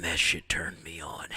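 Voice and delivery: whispered aside. Real, not acted. Whispering a confession to viewers. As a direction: breathe it out as a secret. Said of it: "aside whisper".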